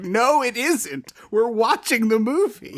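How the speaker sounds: high, drawn-out mock protest; imitating exasperated wife. Wife rejecting the host's impression, exasperated high-pitched protest. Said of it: "And she's like".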